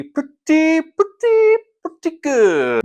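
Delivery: rising teasing build; climbing pretty. Acted.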